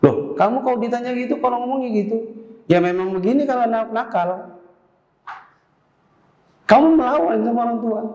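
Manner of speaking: high, indignant parent voice; scolding parent. Acted. Parent outraged at a child talking back, high. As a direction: rise up in outrage at the backtalk.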